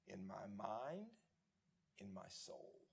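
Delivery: soft, slow and low, almost to herself; hushed reply. Retold or imitated. Bethune answering the donor, soft and slow. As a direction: let the words sink quietly from the heart.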